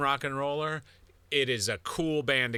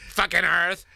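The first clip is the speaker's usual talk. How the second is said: higher, louder comic curse; playful indignant outburst; mock anger; 5 semitones higher, 7 dB louder than usual. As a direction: swear in pretend outrage. Mock rage at the planet.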